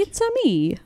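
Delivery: high cartoon plumber voice; Mario voice. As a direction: chirp it like Mario. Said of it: "it's like".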